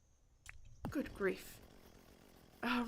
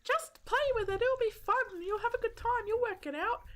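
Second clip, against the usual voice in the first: high, sing-song mock encouragement; high mocking cheerleader voice; mocking chat's advice; 11 semitones higher than usual. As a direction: parrot the viewers in a high chirpy voice. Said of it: "That's what you guys said to me".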